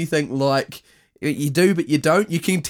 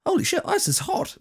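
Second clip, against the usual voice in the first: high squeal of mock shock; a fan's high-pitched holy shit; 3 dB quieter than usual. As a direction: squeak it out like a stunned fan. Imitating a fan's reaction.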